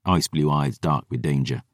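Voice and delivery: ordinary delivery, nothing marked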